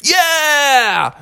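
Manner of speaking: long, high, jubilant cheer; whole family's gleeful cry. Acted. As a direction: draw out a happy whoop. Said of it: "whole Bear family was like".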